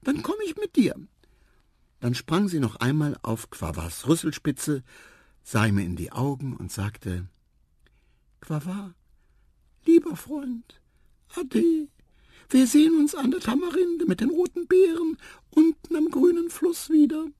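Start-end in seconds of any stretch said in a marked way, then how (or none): 0.0-0.9 very high, tiny mouse voice; squeaky mouse voice. Acted. 8.4-17.3 very high and loud tiny mouse. Acted. Mouse character farewell.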